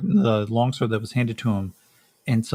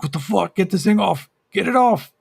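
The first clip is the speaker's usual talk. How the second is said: high, loud panic rising into a scream; terrified shout to get the creature off; panicked screaming; 9 semitones higher, 5 dB louder than usual. Acted. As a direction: yell it harder with each line as the thing grabs you. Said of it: "he starts screaming".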